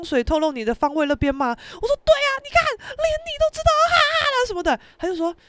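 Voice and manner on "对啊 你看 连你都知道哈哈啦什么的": very high-pitched, triumphant squeal; retold vindication. Vindicated by boxing friend, shrill-pitched exclamation. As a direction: exclaim that you are proved right.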